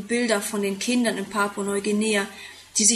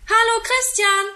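high, louder call from a window; 11 semitones higher, 6 dB louder than usual. A narrator's reading. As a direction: call out to a friend outside. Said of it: "ruft er aus dem Fenster".

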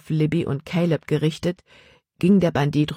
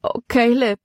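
put-on girl's wailing cry, pitched higher; 6 semitones higher than usual. A narrator's reading. Libby cries out to Caleb after the raid, wailing. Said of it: "jammerte Libby".

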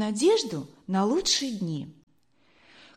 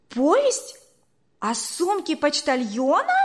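much higher, astonished question; surprised cry; 6 semitones higher, 3 dB louder than usual. Acted. Boy repeats father's story title, astonished. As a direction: gasp out the title in disbelief. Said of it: "вскричал удивлённо Эдуард".

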